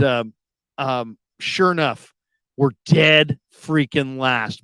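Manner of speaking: ordinary talk, nothing marked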